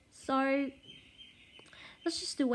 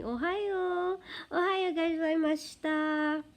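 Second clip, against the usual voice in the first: high, drawn-out Japanese greeting; Japanese morning greeting; 7 semitones higher, slower than usual. Shown as a demonstration. Greeting someone she knows in the morning, high Japanese greeting. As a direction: lift the pitch into a sing-song hello. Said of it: "I'd be saying something like".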